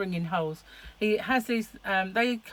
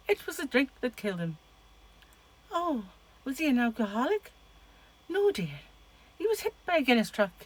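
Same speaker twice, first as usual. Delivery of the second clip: higher-pitched mock Irish accent; attempted Irish accent; 6 semitones higher than usual. Vlogger redoing a film joke, attempted Irish accent. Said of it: "wanted to do it Irish".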